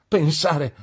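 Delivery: high, loud outburst; excited exclamation. A narrator's reading. Traddles exclaims to his newly returned friend, excited. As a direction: exclaim it in happy disbelief. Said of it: "esclamò Traddles".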